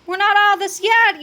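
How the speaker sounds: high, loud warning; urgent yell. A narrator's reading. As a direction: yell a warning to your friend. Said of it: "yelled Fluffy".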